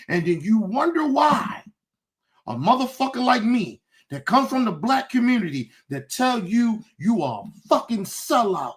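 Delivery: ordinary talk, nothing marked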